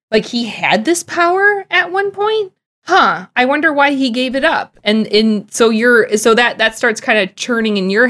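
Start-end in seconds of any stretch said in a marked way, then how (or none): 0.1-4.8 higher, questioning lilt of mild surprise; puzzled realisation. Acted. Podcast guest voicing a viewer's dawning realisation about Arthur, mildly surprised. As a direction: wonder aloud, pitch lifting into the question.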